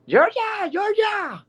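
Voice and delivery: very high-pitched mock scream; screaming fan. Lady running up to the car, imitated high scream. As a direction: squeal the name like a starstruck fan. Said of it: "is screaming".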